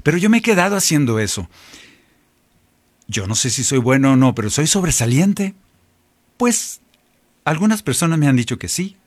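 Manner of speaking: normal talking voice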